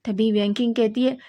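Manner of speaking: plain reading voice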